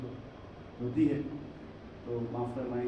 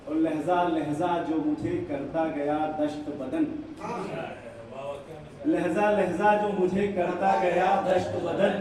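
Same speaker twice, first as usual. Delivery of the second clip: raised, projected Urdu verse declamation; poetry recital; 6 semitones higher, 11 dB louder than usual. Acted. Poet repeating lines for a cheering audience, declaimed ghazal. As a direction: recite to the hall, leaning on the refrain.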